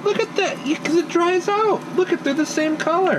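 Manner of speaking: exasperated insisting. Real, not acted. Insisting the bacon is same.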